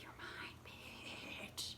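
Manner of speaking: unvoiced whisper, playful menace; whispered threat. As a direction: hiss it into the cat's ear. Grabbing the cat quietly.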